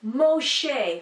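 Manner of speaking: Moshe said slowly, mo-SHEH; slow "Moshe", stressed mo-SHEH; stress on last syllable. Shown as a demonstration. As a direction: lean on the end of Moshe. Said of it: "emphasis placed on the end or middle".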